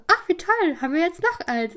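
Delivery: high, loud burst of pleased surprise; acted delighted exclamation, pitched well up; imitated delight. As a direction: play dad's happy surprise at an unexpected treat. Voices delighted dad receiving croissant.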